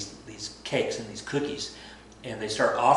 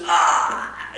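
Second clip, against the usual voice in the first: loud pained cry; 8 dB louder than usual. Retold or imitated. Rasputin stabbed.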